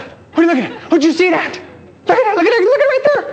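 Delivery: very high, rising frantic voices; excited crowd. Pretend. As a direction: pile on the hysteria.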